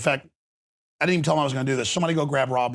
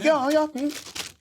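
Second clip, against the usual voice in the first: much higher, dismissive yeah yeah; mocking brush-off; 12 semitones higher, much slower than usual. Mimicking a doctor brushing off a worried patient, dismissive. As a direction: wave the patient off.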